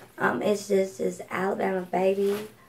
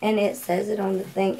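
normal talking voice

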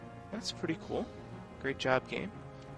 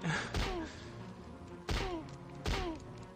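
high chuckle; amused, quiet high laughing; real laugh; 14 semitones higher, 8 dB quieter than usual. Real, not acted. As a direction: let out a high, soft laugh. Laughing at the fight.

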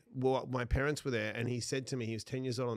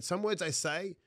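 ordinary talk

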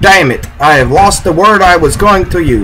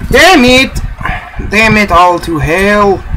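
frustrated outburst; 4 semitones higher than usual. Real, not acted. Frustrated at game failure.

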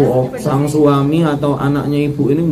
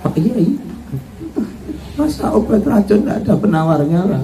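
a lightly higher voice begging for the antidote; pleading daughter-in-law; 6 semitones higher, 3 dB quieter than usual. Acted. Daughter-in-law begs the Kiai for an antidote, lightly pleading.

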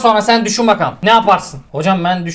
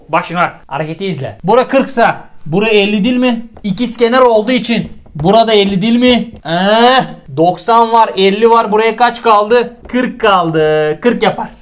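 ordinary delivery

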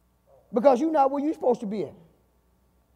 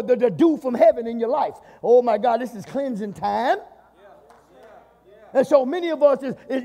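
normal talking voice

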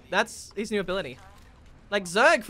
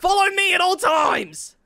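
slow, loud commanding shout, much higher; bossy shout; 10 semitones higher, 8 dB louder, slower than usual. Pretend. Squad leader ordering teammate to follow, slow loud shout. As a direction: bark the order like a drill sergeant. Bossing teammate around in game.

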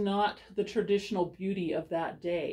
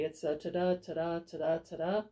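ta-da chanted unstressed then stressed; iambic rhythm. Shown as a demonstration. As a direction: tap out "ta-da" as weak-strong beats. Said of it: "an iamb is an unstressed stress syllable".

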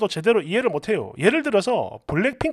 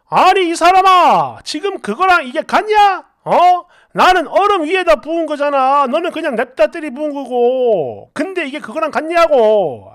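acted exasperation; 3 semitones higher, 4 dB louder than usual. Husband disputing wife's comparison, exasperated arguing. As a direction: argue it out in exasperated disbelief.

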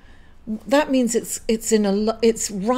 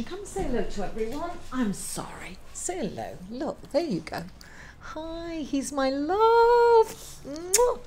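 soft, swooping coos to a dog; doting pet talk; 7 semitones higher, 4 dB quieter than usual. Real, not acted. Owner introducing her beloved dog to viewers, softly cooing. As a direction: coo lovingly, much softer, ending on a kiss.

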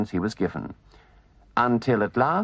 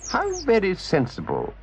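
gentleman voice; 8 semitones higher than usual. Acted.

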